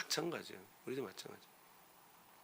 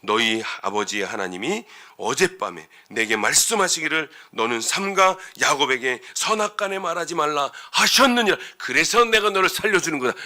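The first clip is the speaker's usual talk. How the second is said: acted speech building louder and higher; declaring Laban; 3 semitones higher, 6 dB louder than usual. Laban telling Jacob why he is sparing him, declaiming. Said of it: "근데 라반이 이야기해요".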